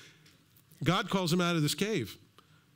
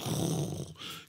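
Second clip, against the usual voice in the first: long voiceless blast of breath; imitated lightning whoosh; 4 dB quieter than usual. God's lightning hits the sacrifice, breathy whoosh. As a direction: whoosh like fire sweeping down.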